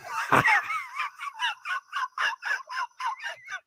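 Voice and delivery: helpless shriek of laughter. Real, not acted. Host breaks up over listener message.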